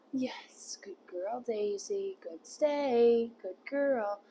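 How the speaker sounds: hushed, gentle praise for a good dog; soft praise. Real, not acted. Trainer softly praising puppy Daisy for holding her stay, hushed.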